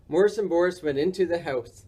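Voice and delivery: usual speaking voice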